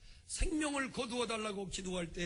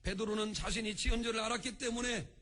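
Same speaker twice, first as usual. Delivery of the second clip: normal talking voice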